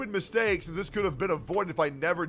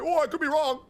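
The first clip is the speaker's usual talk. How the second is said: high, louder whiny mimicry; mocking quote; 8 semitones higher, 3 dB louder than usual. Imitating the accuser's fake apology in a high, snide voice. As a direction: parrot his excuse in a high, snide voice. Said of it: "and then you say".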